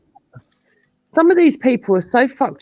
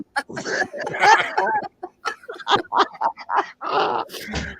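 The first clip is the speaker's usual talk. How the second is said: long laugh. Real, not acted.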